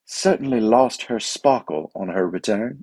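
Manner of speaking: put-on slow, posh BBC tennis commentator in a British accent; British accent like a BBC Wimbledon commentator. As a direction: mimic a British radio announcer's measured tone. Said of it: "That was a good British accent".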